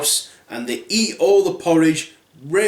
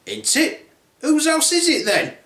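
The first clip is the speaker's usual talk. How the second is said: put-on voice climbing high; lightly acted girl, questioning higher and higher; Goldilocks; 9 semitones higher than usual. Girl asked about the house, puzzled protest. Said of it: "Goldilocks then said".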